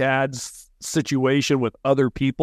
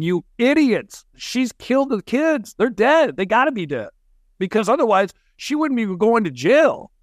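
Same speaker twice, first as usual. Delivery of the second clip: higher-pitched mock yell at the TV; retold scream; 7 semitones higher than usual. Brother retelling his outburst at the TV news, mock screaming. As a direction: reenact screaming at the television, exasperated but not full volume. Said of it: "I was screaming at the T V going".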